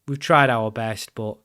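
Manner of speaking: ordinary delivery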